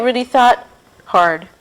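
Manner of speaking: usual speaking voice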